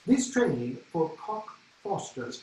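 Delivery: put-on slow, careful English announcer accent; lightly put-on English accent. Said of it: "that delicious English accent".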